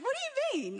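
high-pitched, incredulous mock question; baffled comeback. Child answering friends about her mum, baffled. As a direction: shoot up high in puzzlement.

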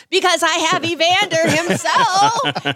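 high, loud, thrilled shout; excited screaming. Retold or imitated. Reporter tells station she has Holyfield, excited shout. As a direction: blurt the news in a frantic rush. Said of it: "screaming and freaking out".

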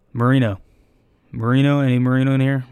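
loudly summoning a test candidate; calling out. Retold or imitated. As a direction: call for him like an official at a test. Official calling out names.

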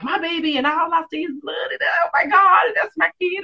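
high, crying shout; mom having a fit. Retold or imitated. Retelling how mom panicked at the birth, high crying shout. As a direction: wail it like a frantic mother. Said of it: "she was having a fit".

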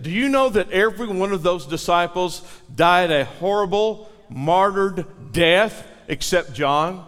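usual speaking voice

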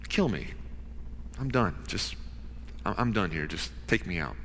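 quiet, soft hopeless voice; despairing plea. Acted. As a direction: let it sink like giving up. Jonah in despair.

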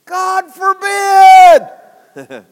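long, drawn-out booming shout; preacher bellowing Paul's answer to the congregation. Retold or imitated. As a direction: roar it slowly like Paul refusing outright. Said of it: "And Paul said".

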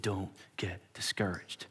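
soft, breathy comfort; older church member comforting, whispered; gentle whisper. Retold or imitated. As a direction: whisper it close, reassuring. Older man's words.

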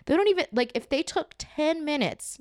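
bitter. Real, not acted. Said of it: "now I sound bitter".

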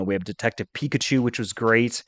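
ordinary delivery

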